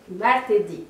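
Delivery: ordinary delivery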